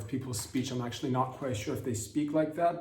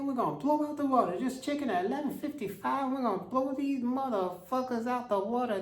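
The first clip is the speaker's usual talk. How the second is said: pitched-up hammed-up bravado; J D Vance; 13 semitones higher than usual. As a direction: squeal it out like a cartoon warmonger. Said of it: "And J D's go".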